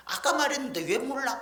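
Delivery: high, sharp scolding of a forgetful parent; impatient child. Retold or imitated. Preacher mimics a child snapping at forgetful parents, high and irritated. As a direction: snap at an old mother. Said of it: "이러지 말고".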